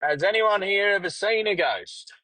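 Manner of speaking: a host calling out to the room. Retold or imitated. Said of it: "bloke yelled out".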